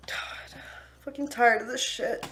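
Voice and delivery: whispered under her breath, worn out; drained whisper to herself; exhausted whisper. Real, not acted. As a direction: breathe it out like you're done. Muttering to herself mid-fight.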